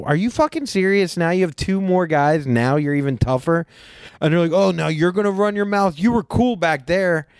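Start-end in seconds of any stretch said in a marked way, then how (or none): 0.0-3.6 high-pitched, worked-up retelling of a challenge; retold exasperated outburst, pitched up; indignant confrontation. Acted confrontation with the golfers. 4.5-7.3 high-pitched, indignant comeback; worked-up mock confrontation, pitched up; retold argument. Golfers argue back in retold quarrel.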